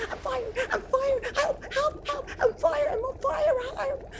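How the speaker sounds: wolf crying for help. Acted. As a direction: howl in pain from the burning water. Wolf falls in boiling water.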